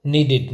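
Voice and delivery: "नीडेड" repeated as NEE-did, short i; firm teacher's model of "नीडेड" with -id; correct pronunciation. Shown as a demonstration. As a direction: end "नीडेड" in -did, not -ded. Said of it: "क्या बोलेंगे इसको".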